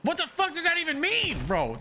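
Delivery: high-pitched, exasperated demand; angry. Real, not acted. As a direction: voice jumping up in disbelief and anger. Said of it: "That's why I'm so angry".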